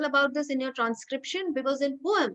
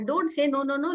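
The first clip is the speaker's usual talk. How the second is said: ordinary talk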